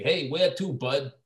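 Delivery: mock Brooklyn cabbie voice; Brooklyn accent. Actor mimicking the voice dubbed over his movie line, in a Brooklyn accent. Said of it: "like a Brooklyn accent".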